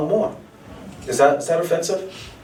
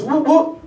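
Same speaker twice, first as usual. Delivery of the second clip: siren imitation; 11 semitones higher than usual. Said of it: "And he goes".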